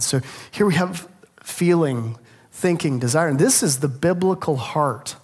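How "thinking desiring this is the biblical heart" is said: ordinary delivery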